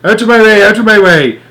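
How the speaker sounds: put-on high, loud old woman's shout; grandma shouting to clear a path. A narrator's reading. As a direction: bellow it while barrelling through.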